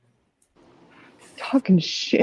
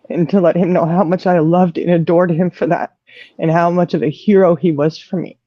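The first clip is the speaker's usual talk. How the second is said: ordinary delivery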